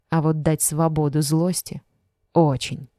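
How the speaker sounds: ordinary delivery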